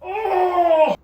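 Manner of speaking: sharp high yelp; startled cry. Real, not acted. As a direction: cry out in real shock. Venom drips, startled cry.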